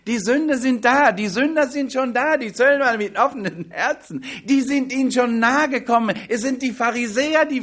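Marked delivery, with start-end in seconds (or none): none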